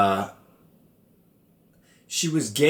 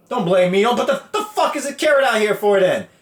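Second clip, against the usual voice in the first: indignant rant; 10 semitones higher than usual. Real, not acted. Defending himself to mom over the carrot, mock outrage. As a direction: protest your innocence hammed up.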